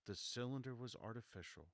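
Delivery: usual speaking voice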